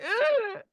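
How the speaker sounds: pained yelp. Retold or imitated. Imitating pain cry.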